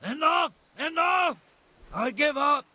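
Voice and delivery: desperate high shout. Acted. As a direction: yell it to stop the beating. Beaten man gives up.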